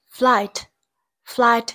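flight with its vowel read as "I", as in "eye"; long I sound. Shown as a demonstration. Teacher modelling pronunciation for students, long I in flight. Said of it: "Y được đọc là".

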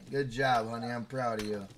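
ordinary delivery

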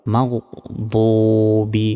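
মাগদুবি slow, with thick rounded held doo; heavy dad. Shown as a demonstration. Teacher models the corrected word, slow heavy মাগদুবি. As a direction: pause after magh, thicken মাগদুবি. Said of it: "মোটাভাবে".

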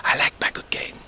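secret little confession, whispered; whispered aside. Acted. The count confessing to viewers in a whisper. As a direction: breathe it like a secret. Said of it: "unvoiced whisper".

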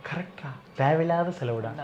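soft confiding undertone; quiet, close-up. Shown as a demonstration. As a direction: say it gently so she won't overhear. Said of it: "பொறுமையா கிட்ட வந்து சொல்ற". (மெதுவாக, கிட்ட வந்து ரகசியமாக)

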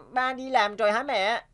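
ordinary delivery

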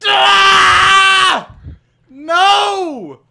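long, high, loud wail; anguished scream. Real, not acted. Player losing what they worked for, anguished scream. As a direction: scream it out in defeat. Loses hard-won kill.

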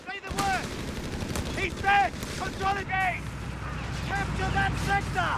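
soldier yelling under fire; urgent combat shout. Acted. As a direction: shout over the firefight.

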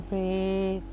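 normal talking voice

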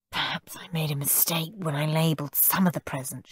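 whispered, soft aside; muttering. A narrator's reading. Aunt Ruby mutters quietly to Mum about the labels. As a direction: mutter it so the boys can't hear. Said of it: "she muttered to Mum".

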